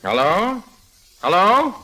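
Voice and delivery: rising hello into the receiver; calling hello on phone. Acted. Harry Lime calls into a silent phone, rising hello. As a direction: check if anyone is on the line.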